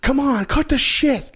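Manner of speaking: very high, slow, mock panicked yell; panicked kid. Imitating the movie kids in a panic, very high. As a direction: play a frightened kid snapping at his friends. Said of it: "the panic in some of them".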